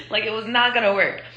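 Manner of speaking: normal talking voice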